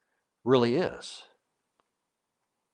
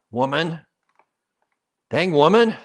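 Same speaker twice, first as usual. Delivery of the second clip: higher, louder mock scold of woman; mock insulting; 5 semitones higher, 7 dB louder than usual. Shown as a demonstration. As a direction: snap it like an insult, hammed-up. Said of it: "comes across as insulting and derogatory".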